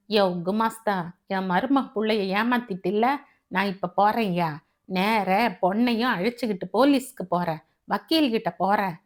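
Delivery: usual speaking voice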